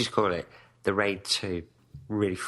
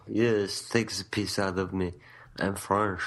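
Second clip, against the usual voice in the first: mock French accent; Vincent Cassel; 2 semitones lower than usual. French actor accepting the mockery, imitated French accent. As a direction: shrug it off in hammed-up French. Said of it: "He said that, Vincent Cassel".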